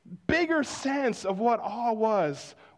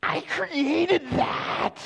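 proud exclaim; 5 semitones higher, much slower than usual. Retold or imitated. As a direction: proclaim it slowly, awestruck and proud. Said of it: "You're like".

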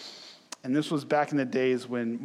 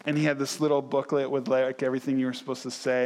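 ordinary talk

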